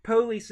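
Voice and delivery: "Police" as PO-lees with a full O; urban pronunciation. Shown as a demonstration. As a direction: stress the first syllable of "Police". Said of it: "more of an urban pronunciation".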